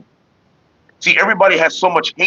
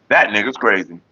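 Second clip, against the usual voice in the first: usual speaking voice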